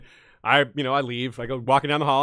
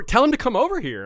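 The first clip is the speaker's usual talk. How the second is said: excited urging; 10 semitones higher than usual. Retold or imitated. Cosplayers urging her, retold.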